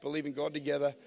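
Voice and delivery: ordinary talk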